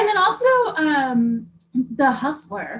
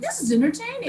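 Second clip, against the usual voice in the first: mock reaction; 10 semitones higher than usual. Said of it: "it'd be like".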